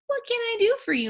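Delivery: high, fast squeak of a tiny mouse queen; squeaky little mouse voice. A narrator's reading. Mouse queen offering help to travelers, high quick squeak. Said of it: "asked in her squeaky little voice".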